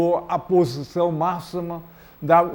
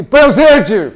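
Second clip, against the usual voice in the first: shouting presente; 5 semitones higher, 9 dB louder than usual. Real, not acted. Crowd roll call shout.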